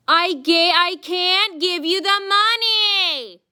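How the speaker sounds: high, loud, very slow mocking whine; mocking whiny clerk. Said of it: "she was like".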